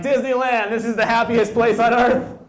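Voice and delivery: high, loud, gushing delight; giddy Disney joy. Retold or imitated. Comic at Disneyland, overjoyed and squealing high and loud. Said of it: "I was like".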